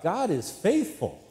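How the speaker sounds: high, slow call to the congregation; demonstrated yell. Shown as a demonstration. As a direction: yell it out, stretching the words. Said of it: "if I was to yell out".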